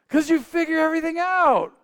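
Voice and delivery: exasperated retort. Retold or imitated. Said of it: "I'm like".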